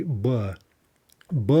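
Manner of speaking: b as a bare consonant with no vowel. Shown as a demonstration. Teacher showing the lone consonant b. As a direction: clip the b with no vowel after it. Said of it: "when I sit here and say b".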